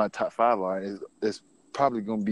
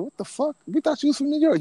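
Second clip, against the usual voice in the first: much higher, stunned disbelief; shocked disbelief. Retold or imitated. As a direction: squeal it as if shocked. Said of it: "They were like".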